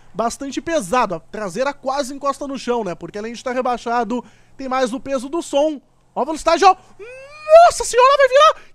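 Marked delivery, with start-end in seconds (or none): 6.1-8.7 high, loud yelp as the car nearly flips; excited shout. Real, not acted. Gamer yelling to viewers as his car nearly flips, high excited shout. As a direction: shriek it like the car is about to roll over.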